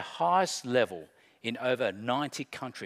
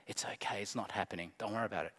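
much softer and lower, brushing it off; hushed mock reassurance; 6 semitones lower, 10 dB quieter, much faster than usual. Preacher voices disciples pretending all is fine, hushed. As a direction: play down the danger in a low hush. Voicing denial of the storm.

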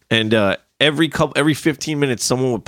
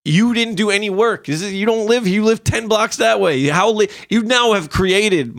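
indignant rant; 8 semitones higher than usual. Real, not acted. Ranting at spot savers.

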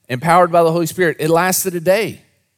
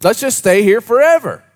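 mock eager disciples; 6 semitones higher, 5 dB louder than usual. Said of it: "All they needed was some [name]".

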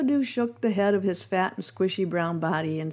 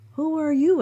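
a hint of a higher, curious little creature; lightly voiced caterpillar; 7 semitones higher, 4 dB louder than usual. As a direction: ask it as a timid caterpillar. Bumpadoo voiced in parable.

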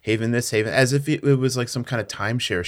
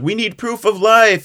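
loud, high-pitched retold demand; mock demand; 11 semitones higher, 8 dB louder than usual. Someone demanding proof from covid, loud mock demand. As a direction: call it out urgently.

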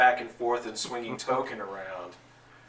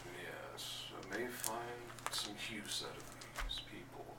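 plotting quietly to himself; ominous murmur; 15 dB quieter than usual. Acted. Monitor eyeing the fighters from the crowd, ominous murmur.

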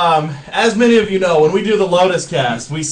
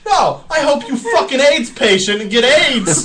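high-pitched mock angry holler; hammed-up outburst, pitched up; imitated heckler; 7 semitones higher, 3 dB louder than usual. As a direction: act out a bystander yelling abuse. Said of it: "some guy out there goes".

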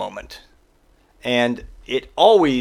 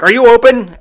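higher and louder, pushy; impatient demand; 9 semitones higher, 9 dB louder than usual. Retold or imitated. Waiting customer demands at the door, loud and pushy. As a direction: bark the question at the clerk. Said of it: "And he's like".

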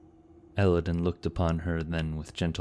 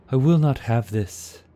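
raised, louder defiant cry; Maeve cries out; 8 semitones higher, 7 dB louder than usual. A narrator's reading. Maeve refusing the retreat, loud defiant cry. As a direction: throw it out in protest. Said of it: "cried Maeve".

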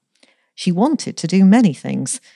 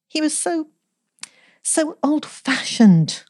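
high, slow plaintive wail; mock-tearful wail; 11 semitones higher, slower than usual. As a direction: drag it out like a sulky child. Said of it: "wailed Elsie".

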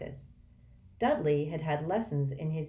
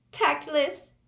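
ordinary delivery

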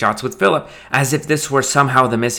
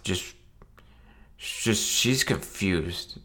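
put-on drunken mumble; 9 dB quieter, much slower than usual. Drunk groom despairing.

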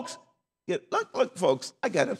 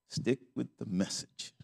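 quiet repeat; 6 semitones lower, 10 dB quieter, slower than usual. Shown as a demonstration.

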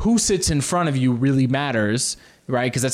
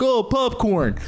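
caving customer; 9 semitones higher, slower than usual. Acted.

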